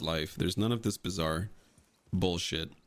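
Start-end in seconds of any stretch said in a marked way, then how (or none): none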